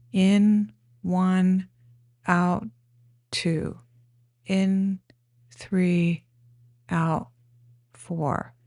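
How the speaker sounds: single words with a pause after each; breath counting. Shown as a demonstration. Guide showing how to count breaths up to four, breath counting. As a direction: count slowly in time with breathing. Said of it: "do a counting of the breath".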